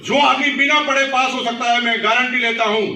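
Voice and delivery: loud, high pep talk; rousing motivation. Shown as a demonstration. Parent motivating child who passed without studying, rousing and loud. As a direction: pump up the listener with total conviction.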